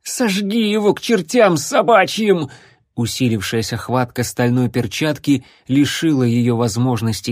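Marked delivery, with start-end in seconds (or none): 0.0-2.5 high, strained outburst from a squeezed throat; choked cry. A narrator's reading. Captive girl urges princess to burn the necromancer, choked cry. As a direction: push each word out high, half-strangled.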